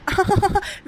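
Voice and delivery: high teasing laugh at her fear; mocking laugh. Driver laughs at her scared sister-in-law, high teasing cackle.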